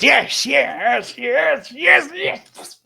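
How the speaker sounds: very high, loud chant of yes; eager old wizard. Acted. Wizard reading the gentleman's mind, very high and loud. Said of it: "And the man goes".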